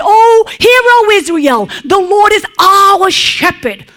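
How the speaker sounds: high, loud public proclamation; proclaiming loudly. Pretend. David as he might have proclaimed it to Israel, loud and high. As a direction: call it out to a whole nation. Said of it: "Now, he could have said".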